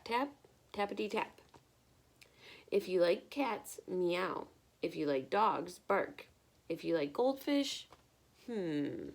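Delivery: lively picture-book read-aloud; bright, expressive storytime reading; reading to children. Acted. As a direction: read it like storytime for little ones.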